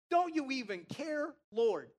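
high, loud accusing plea; mock-indignant wail of complaint; guilt-tripping. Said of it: "she guilt trips the Lord".

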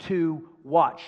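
emphatic, accusing put-down; scolding in forceful bursts with long pauses; speaking down. Acted. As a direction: talk down to an unwelcome younger brother. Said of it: "The distracting voice of defeat always speaks down to you".

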